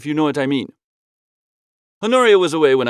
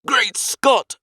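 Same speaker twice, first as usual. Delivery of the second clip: higher, eager exclamation; excited cry; 7 semitones higher than usual. A narrator's reading. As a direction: struck by a bright idea. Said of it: "I cried".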